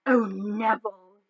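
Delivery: put-on slow, sighing old gran; old woman sighing. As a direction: sigh it out, long-suffering. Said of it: "he heard the old woman sigh".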